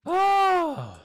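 loud high startled oh; surprised oh. Real, not acted. Gamer seeing the village at last, loud surprised oh. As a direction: gasp then burst out oh. Arriving just in time.

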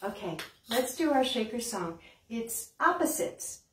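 usual speaking voice

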